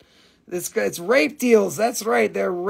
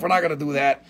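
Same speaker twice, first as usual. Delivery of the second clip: ordinary delivery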